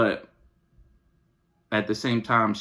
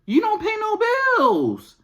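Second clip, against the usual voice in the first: very high, fed-up scolding; exasperated rant; 3 dB louder than usual. Acted. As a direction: throw it at the kids in a high, exasperated pitch. Second take, acted rant.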